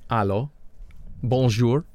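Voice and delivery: "allô, bonjour" said with a French lilt, rising at the end; French greeting. Shown as a demonstration. Host greeting French-speaking listeners, French pronunciation. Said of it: "for those of you listening in French".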